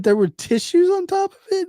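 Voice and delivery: high, incredulous question; disgusted disbelief. Retold or imitated. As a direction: sound appalled. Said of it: "I said".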